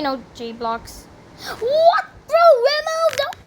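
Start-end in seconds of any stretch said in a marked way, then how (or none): 1.5-3.4 high-pitched, incredulous outcry; high squeal of bewilderment; startled disbelief. Real, not acted. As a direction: yelp in shock at the game. Startled mid-game.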